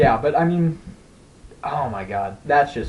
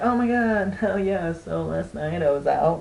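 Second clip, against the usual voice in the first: mock girly chatter, pitched up at the start; mimicked mall girl; 3 semitones higher than usual. Teenage girls gossiping on a playground, mock girly chatter.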